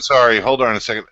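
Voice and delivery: usual speaking voice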